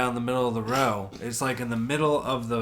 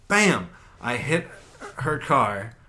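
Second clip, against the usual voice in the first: ordinary delivery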